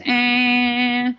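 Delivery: long, steady droning honk; mimicked continuous car horn; imitated car horn. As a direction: hold one level blare like a stuck horn. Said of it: "all I hear is".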